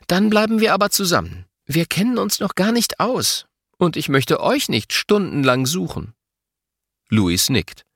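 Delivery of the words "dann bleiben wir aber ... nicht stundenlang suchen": sceptical. A narrator's reading. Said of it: "Mama ist skeptisch".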